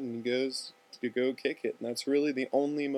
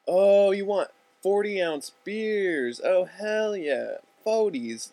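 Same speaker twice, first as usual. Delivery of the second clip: slow, loud, higher-pitched dawning delight; drawn-out, hammed-up "oh, now I get it"; eager realization; 8 semitones higher, 6 dB louder, slower than usual. Finally understands, eager about beer.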